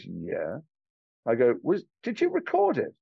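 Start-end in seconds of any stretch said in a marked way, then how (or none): 0.0-0.6 wary. Retold or imitated. Wary reply from a stranger he phoned. 1.6-2.9 eager question. Retold or imitated. Eager question to the interviewer, retold.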